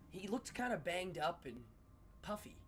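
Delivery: ordinary delivery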